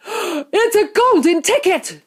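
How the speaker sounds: high, loud, drawn-out astonished cry; screaming. A narrator's reading. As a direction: scream it slowly in amazement as the shopkeeper. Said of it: "screamed the shopkeeper".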